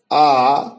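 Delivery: "आ" held twice as long as "अ"; long vowel demo. Shown as a demonstration. Teacher showing students the long vowel, drawn out. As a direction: draw out "आ" to double length. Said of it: "आ बोलने में उससे दो गुना वक्त लगना चाहिए".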